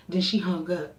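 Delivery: normal talking voice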